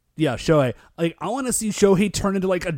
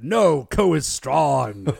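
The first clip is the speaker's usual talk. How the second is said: slow, firm little voice; a tiny baby insisting he is strong; baby declaring; 2 semitones lower, 4 dB louder, much slower than usual. Retold or imitated. Baby insists to Bara Baby, slow and firm. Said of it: "he's like".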